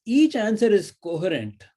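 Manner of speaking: ordinary talk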